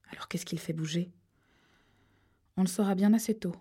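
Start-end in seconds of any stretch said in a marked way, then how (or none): 0.1-1.1 hushed unvoiced question; whisper, uneasy about the body. Characters whispering near a corpse. (question chuchotée)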